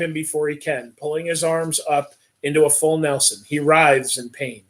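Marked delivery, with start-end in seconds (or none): none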